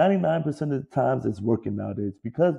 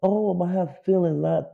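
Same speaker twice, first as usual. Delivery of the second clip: higher, whiny crybaby voice; mocking player; 7 semitones higher than usual. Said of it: "NBA players".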